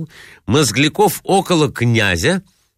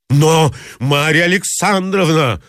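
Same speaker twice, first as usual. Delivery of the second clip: outcry; 6 semitones higher than usual. Acted. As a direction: burst out at her. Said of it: "вскричал он". (высокий, громкий возглас)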